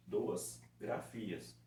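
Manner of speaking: "duas grafias" very slow and soft; slow and explained. Shown as a demonstration. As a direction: spell it out slowly. ("duas grafias" bem devagar, explicando)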